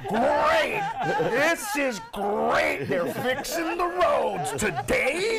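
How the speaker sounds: hammed-up sarcasm, slow and loud; sarcastic complaint. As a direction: sneer at the road works, dragging each word. Mocking complainers about road works.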